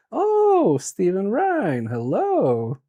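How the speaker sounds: normal talking voice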